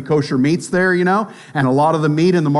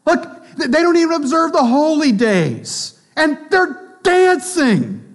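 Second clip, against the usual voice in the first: higher, indignant pious voice; self-righteous believer; 5 semitones higher than usual. Retold or imitated. Weak believer condemning the strong, higher and scandalized. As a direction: climb higher with scandalized piety.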